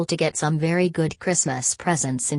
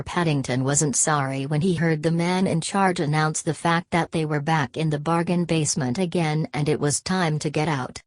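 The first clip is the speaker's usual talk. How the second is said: ordinary delivery, nothing marked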